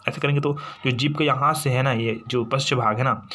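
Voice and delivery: ordinary talk, nothing marked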